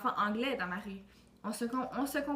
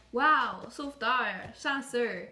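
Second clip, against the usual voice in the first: loud mock wow, impressed; 7 dB louder, much slower than usual. Imagined listeners envying his lifeguard job, mock impressed. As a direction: imitate listeners' envious wow. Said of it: "Vous devez être en train de vous dire".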